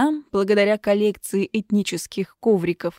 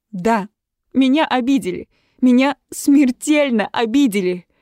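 loud, wounded protest; louder, with mock-tragic indignation; indignant cry; 4 semitones higher, 6 dB louder than usual. A narrator's reading. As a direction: cry out that you've been mortally hurt. Heroine shouts at her family.

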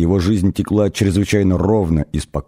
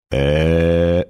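long, low, level hesitation sound; dumbfounded "eh"; 4 semitones lower than usual. A narrator's reading. Arthur speechless after the insult, dumbfounded drawn-out "eh". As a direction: hold a lowered "ehh", lost for words.

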